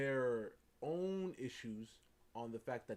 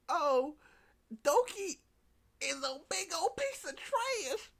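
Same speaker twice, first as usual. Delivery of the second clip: high, loud, drawn-out jeer; mock trash-talk; 7 dB louder, slower than usual. Cat trashing Doki in a video, high slow mock jeer. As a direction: stretch the insult out, hammed-up. Cat's video attack, acted.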